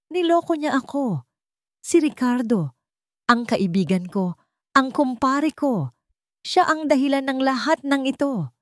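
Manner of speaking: angry shout. A narrator's reading. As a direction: yell out the betrayal at his wife. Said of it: "Sigaw ng kanyang ama".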